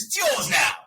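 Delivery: high, exclaimed mock British accent; over-the-top British accent, pitch swooping up; Hugh Grant's over-the-top accent. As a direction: ham up a terrible British accent. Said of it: "it was just so over the top".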